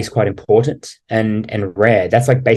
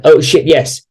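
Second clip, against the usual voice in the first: louder, higher burst of eager agreement; eager yes; 6 semitones higher, 5 dB louder than usual. Retold or imitated. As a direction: jump at the offer. Girl's eager reply, acted out.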